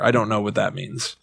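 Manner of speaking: ordinary talk, nothing marked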